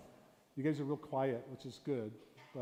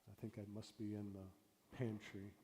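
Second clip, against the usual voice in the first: mother thinking aloud; 3 semitones lower, 7 dB quieter than usual. Retold or imitated. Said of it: "she was thinking".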